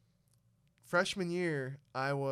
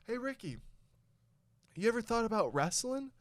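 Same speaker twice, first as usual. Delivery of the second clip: high, friendly call from the coach; coach's voice; 8 semitones higher than usual. Retold or imitated. Coach calling to a freshman in the hall, high and upbeat. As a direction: sing out his name like a cheerful coach. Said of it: "Coach Green goes".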